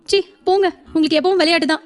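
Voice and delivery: wife coyly scolding her teasing husband; coy whine. Acted. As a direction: shyly scold him with a pout. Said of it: "சினுங்கவும்".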